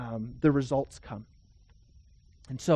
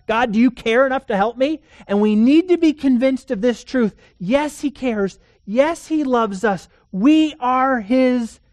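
rising, louder pulpit voice, building; fervent preaching; 6 semitones higher, 8 dB louder than usual. Acted. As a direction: preach it out with growing fire. Preaching at full force.

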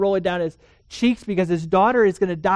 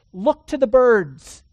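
emphatic preaching; 5 semitones higher, 8 dB louder, slower than usual. Acted. Preached line, louder.

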